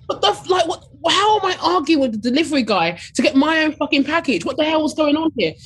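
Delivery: high, exasperated disbelief. Retold or imitated. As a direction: vent at the absurd situation. Exasperated rant at delivery guy.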